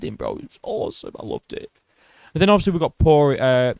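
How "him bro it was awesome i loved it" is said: hushed whisper. Real, not acted. Fan whispering his love for the slam. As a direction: breathe it out in an awed whisper. Savoring the takedown in a hush.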